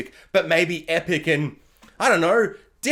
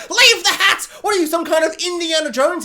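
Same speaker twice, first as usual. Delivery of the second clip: high, loud scolding shout; exasperated yell; 10 semitones higher, 6 dB louder than usual. Acted. Woman telling him to leave the hat, acted high yell.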